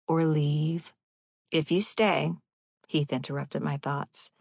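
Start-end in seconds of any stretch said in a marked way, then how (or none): none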